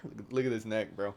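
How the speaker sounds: usual speaking voice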